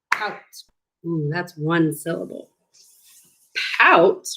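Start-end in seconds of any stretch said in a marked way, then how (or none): 0.1-0.4 clapped out. Shown as a demonstration. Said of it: "Let's clap it out".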